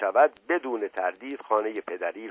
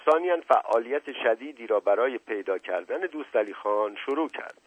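usual speaking voice

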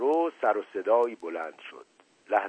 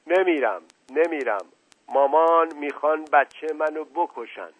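higher, louder tearful protest; tearful, sobbing; 6 semitones higher, 5 dB louder than usual. A narrator's reading. Crying girl pleading for her baby, tearful. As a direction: protest as if through sobs. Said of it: "زد زیر گریه و حق حق کنان".

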